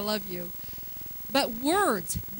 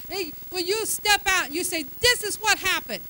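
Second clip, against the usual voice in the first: loud, higher, climbing preacher's declaration; full-force preaching; 6 semitones higher, 8 dB louder than usual. Acted. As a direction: preach it boldly to the whole congregation.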